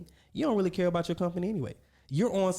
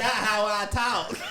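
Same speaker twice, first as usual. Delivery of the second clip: laughing; 9 semitones higher, slower than usual. Real, not acted. Laughing denial of the accent.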